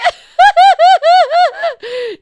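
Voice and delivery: very loud, high cackling fit; helpless shrieking laugh; uncontrolled laughter. Real, not acted. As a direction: explode into high-pitched laughter. Apologizing amid overwhelmed laughter.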